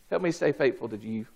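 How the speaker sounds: low, soft, quick plea; quiet prayer. Acted. Preacher prays to God as job gets tough, quietly. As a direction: drop down into a humble aside. Prayer answering each raised line.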